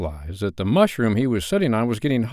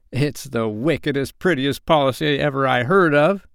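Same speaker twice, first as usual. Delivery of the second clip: higher, louder admiring pirate; admiring cry; 5 semitones higher, 4 dB louder than usual. Acted. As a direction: gush with delight. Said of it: "he cried".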